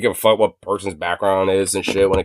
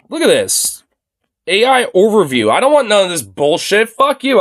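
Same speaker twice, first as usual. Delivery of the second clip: outraged rant; 9 semitones higher, 4 dB louder than usual. Real, not acted. Angry at the AI overview.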